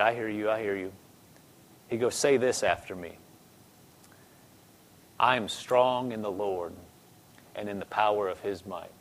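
soft, low, hushed voice; gentle coaching. Retold or imitated. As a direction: speak very quietly to someone frail. Minister gently coaching a sick woman.

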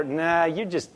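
low, quiet brush-off; dismissive doubter. Retold or imitated. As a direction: mimic someone waving away good news.

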